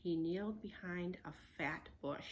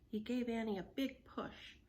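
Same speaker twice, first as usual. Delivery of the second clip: ordinary delivery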